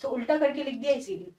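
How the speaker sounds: normal talking voice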